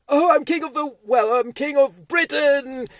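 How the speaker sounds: high, loud gloating puppet proclaiming; a puppet crowing that he's king; high loud triumphant cartoon ruler; Boris puppet voice.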